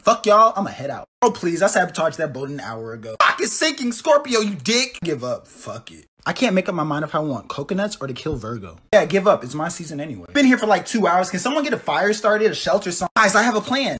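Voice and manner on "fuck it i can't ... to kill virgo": ordinary talk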